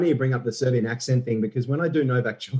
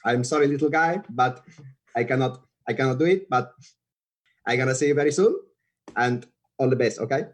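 Rafa; 3 semitones higher than usual. Acted. Rafa turning down the little guy for a quick game, apologetic accented impression. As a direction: gently let them down in a Spanish accent. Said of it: "Rafa just looked at me and he goes".

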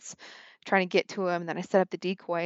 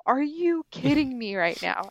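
higher, slower exasperated disbelief; retold disbelief; 6 semitones higher, slower than usual. Hunter retelling his reaction to the helicopter, exasperated disbelief. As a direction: drag it out like you can't believe your luck.

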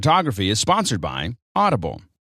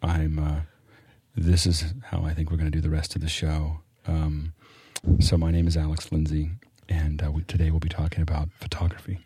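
late-night radio host; 6 semitones lower than usual. Pretend. Introducing the show in a mock serious late-night radio host voice. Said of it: "this sounds like a Loveline thing".

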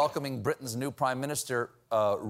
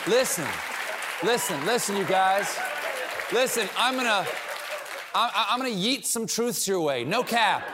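youth pastor; 6 semitones higher than usual. Retold or imitated. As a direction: try way too hard to sound cool. Youth pastor slang bit.

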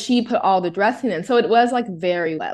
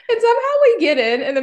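high laughing burst; 12 semitones higher, 4 dB louder than usual. Real, not acted. Retelling the family scuffle, laughing high. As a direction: laugh through the words.

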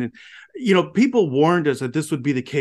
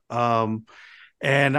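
usual speaking voice

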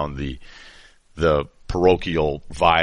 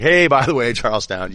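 higher, louder mock announcement, easing off; mock shout-out; 6 semitones higher, 4 dB louder than usual. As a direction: call out a mock credits message. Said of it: "they have this paragraph in the credits".

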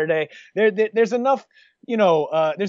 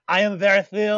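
light mock Ukrainian accent; Usyk accent. Imitating Usyk's catchphrase for his cohost, Ukrainian accent. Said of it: "He's got".

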